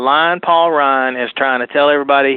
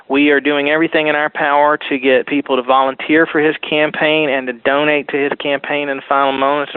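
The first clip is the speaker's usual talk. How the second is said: ordinary delivery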